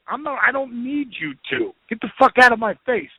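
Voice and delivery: mild mock outburst, pitched high; indignant brush-off, replayed for a listener; retold defiant refusal. Acting out his defiant refusal.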